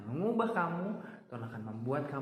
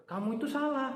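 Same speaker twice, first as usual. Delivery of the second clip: high, louder scolding voice; mock rebuke; 12 semitones higher, 4 dB louder than usual. A subject rebuking the proud king, sharp and high.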